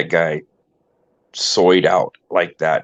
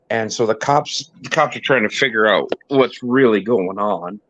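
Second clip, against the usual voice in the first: ordinary talk, nothing marked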